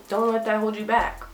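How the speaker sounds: ordinary talk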